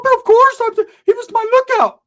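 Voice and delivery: high squeaky falsetto; hammed-up squeaky sidekick; Ricky's voice. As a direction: pitch way up into a goofy falsetto. Ricky voiced in retold story.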